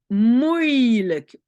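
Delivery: "moeilijk" slow, the oei stretched and loud; vowel demo. Shown as a demonstration. Teacher shows learners the oei sound, slow loud demo. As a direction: draw out the oei inside "moeilijk". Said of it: "oei est toujours écrit comme ça".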